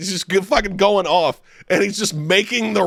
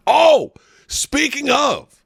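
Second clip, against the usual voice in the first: sudden realization; 9 semitones higher, much slower than usual. Real, not acted. Sudden remembered topic.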